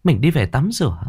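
high-pitched woman's voice, coaxing; coquettish female lover. Acted. Woman urging her lover to hurry home, coquettish and coaxing. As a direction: pitch it high and wheedle like a girlfriend.

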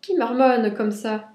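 puzzled mother's loud, drawn-out question; mother on the phone. A narrator's reading. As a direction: demand who is mumbling, drawing it out. Mother on phone cannot make out caller.

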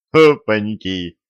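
loud, slow delighted exclaim; pleased to see him; gleeful. Acted. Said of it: "обрадовался юноша".

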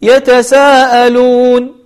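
slow recitation, saa of يتساءلون cut to two beats; madd too short. Shown as a demonstration. Teacher showing what not to say, shortened madd. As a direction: chant يتساءلون with only a brief aa. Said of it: "ولا نقول".

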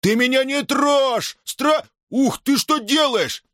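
очень высокий протяжный вскрик; отбивайся криком на самой высокой ноте; возмущённый крик. Acted. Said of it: "что не ори". (возмущённо, высоким криком)

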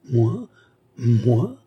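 rising, astonished question; stunned outcry with an upward swoop; strange incredulous cry. A narrator's reading. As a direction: exclaim it twice as if unable to believe it. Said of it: "s'écria-t-elle avec un accent étrange".

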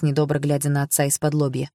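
plain reading voice

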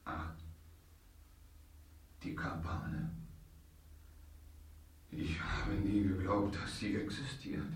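tonlos geflüstertes Staunen; ungläubig vor sich hin flüstern. Acted. Marquis erkennt die Geheimsitzung. (Marquis flüstert erstaunt über die Kabale)

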